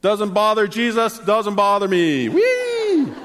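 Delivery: high, loud gleeful cry with hands up; carefree joyful whee. Pretend. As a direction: squeal like riding a roller coaster. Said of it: "throwing their hands up and go".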